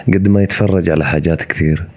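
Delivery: normal talking voice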